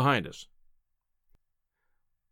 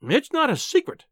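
high-pitched wifely protest; exasperated, high outburst; indignant cry; 10 semitones higher than usual. A narrator's reading. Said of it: "she cried".

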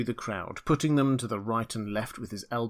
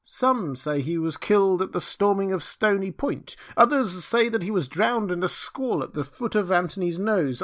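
put-on high-pitched old character voice; old villager recounting; 8 semitones higher, 4 dB louder than usual. A narrator's reading. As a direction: recall village news in a high voice. Villager answers Rip, acted.

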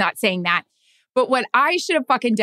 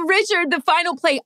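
a hint of a stiff reporter voice, higher; lightly mocking stiff sideline reporter; mock reporter question; 9 semitones higher than usual. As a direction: mimic your own clumsy interview opener. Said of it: "My first question sucked ass".

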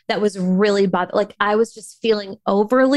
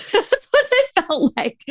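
high-pitched words through laughter; real amused laugh bubbling into speech; 10 semitones higher than usual. Real, not acted. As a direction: giggle through the line. Laughs at her exorcism comparison.

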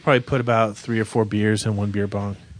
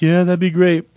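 higher, louder mock-dazed reply; imitated dazed self; 6 semitones higher, 5 dB louder than usual. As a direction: play yourself woozily accepting help. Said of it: "I'm like".

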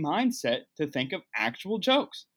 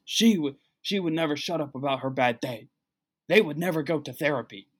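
lightly voiced overdramatic complainer; a hint of parodying trendy therapy talk; mock complainer. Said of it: "Everyone likes to use".

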